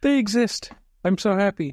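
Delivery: high, thrilled squeal; excited. Real, not acted. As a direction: gush with delight. Thrilled about new listeners.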